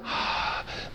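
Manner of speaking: long audible sigh out; deep sigh out. Shown as a demonstration. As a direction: let the breath go with a sigh. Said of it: "Let it out".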